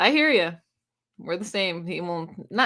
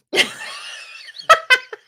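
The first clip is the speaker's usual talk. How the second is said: high breathy laugh; 4 dB louder than usual. Real, not acted. Laughing at softies.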